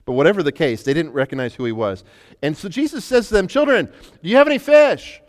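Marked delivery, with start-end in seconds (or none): 2.4-5.2 fervent preaching. Acted. Preaching at full force.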